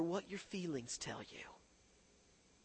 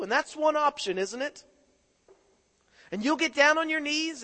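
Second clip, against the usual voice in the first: loud, high, driving preacher voice; a preacher building to a roar over repentance; preaching at full force; 9 semitones higher, 12 dB louder than usual. Acted. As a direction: preach it hard to the congregation.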